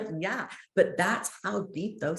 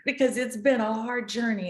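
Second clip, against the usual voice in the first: louder, higher, with a laugh breaking through; laughing while mad; 7 semitones higher, 8 dB louder than usual. Real, not acted. Telling the host about her journey, laughing in exasperation. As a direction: laugh through your frustration. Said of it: "I get mad".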